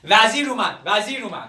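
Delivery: loud alarm call, easing on the repeat; shouting. Acted. Young man announcing the minister to distract him, shouting. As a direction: cry out the big news.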